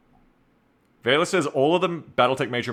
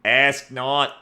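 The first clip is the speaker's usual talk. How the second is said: JFK impression; slower than usual. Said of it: "I can't do my J F K impression".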